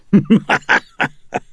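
high, loud, breath-filled cackle; demon cackle. Acted. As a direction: cackle in mocking triumph. Demon laughs at its taunt.

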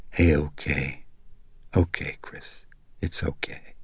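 soft, hushed comfort; calm her down gently, almost whispered; soothing whisper. Acted. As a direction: friend whispering comfort while rubbing her shoulders. Friend soothing sobbing Chris.

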